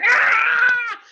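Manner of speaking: long loud high shriek; attacking cat screech. Acted. Wee cat turns on the police, long loud screech. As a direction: let out a sustained cat screech. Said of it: "the wee cat went".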